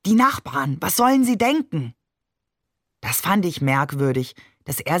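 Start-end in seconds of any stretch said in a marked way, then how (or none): 0.0-2.0 higher-pitched anxious scolding; mildly fretful reproach; worried admonishing. A narrator's reading. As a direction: scold them for making a scene. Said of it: "zischte Ali".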